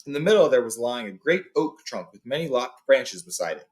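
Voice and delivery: plain reading voice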